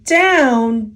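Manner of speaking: ordinary delivery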